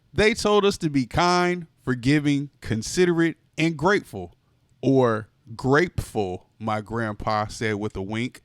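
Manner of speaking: plain reading voice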